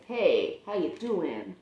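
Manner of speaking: ordinary delivery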